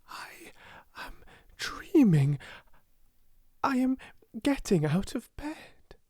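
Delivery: high, halting, hushed start; dazed wonder. A narrator's reading. Girl talking to herself in a dream, dazed wonder. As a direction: tell yourself haltingly, in wonder.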